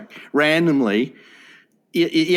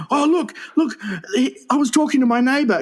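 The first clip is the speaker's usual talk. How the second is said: high, put-on urgent informant; pitched-up mock urgency; reporting to police; 9 semitones higher than usual. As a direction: play an alarmed caller tipping off police. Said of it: "you go to the police and say".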